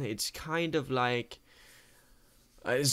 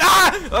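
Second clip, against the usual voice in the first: short high shriek; startled scream; 14 dB louder than usual. Real, not acted. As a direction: yelp at a sudden scare. Sudden scare.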